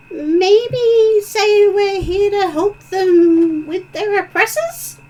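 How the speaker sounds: high, slow tiny fairy voice; pixie voice. Acted. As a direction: coax it like a little pixie. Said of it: "the little pixie flits to Cheval and says".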